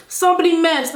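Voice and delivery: re-enacted playground accusation; imitated exasperated insistence. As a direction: insist like a misunderstood kid. Insisting again, acted.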